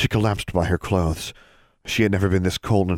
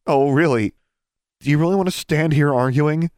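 higher, louder sarcastic jab; sarcastic retort; 9 semitones higher, 5 dB louder than usual. Acted. Alexandra retorts sarcastically to Brian. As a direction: snap back with sarcasm.

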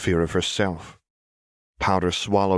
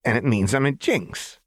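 put-on higher, grinning girl voice; gleeful girl; 6 semitones higher than usual. A narrator's reading. Jinx to Silco, grinning and high. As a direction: say it with a wide grin. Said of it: "wide grin".

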